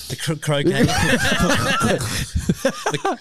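laughing through a stumbled word. Real, not acted. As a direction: giggle while correcting yourself. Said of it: "Can you say that properly?".

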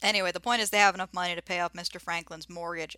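ordinary talk

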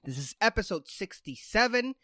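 high, bright show host opening; radio announcer. Acted.

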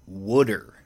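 wooder, first vowel as in wood for water; Philly pronunciation. Retold or imitated. Imitating father's word for tap water, Philly wooder. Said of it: "he calls that wooder".

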